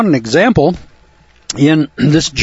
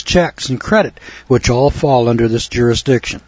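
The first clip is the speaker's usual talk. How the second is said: ordinary talk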